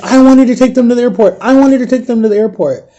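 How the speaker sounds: imitated tearful wail, pitched high; high, lamenting retelling without real sobs; retold crying. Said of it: "falls out and starts crying".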